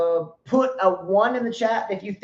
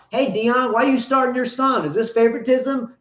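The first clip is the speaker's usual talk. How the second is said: mock reporter; 6 semitones higher, 4 dB louder than usual. Mocking reporter asks Deion.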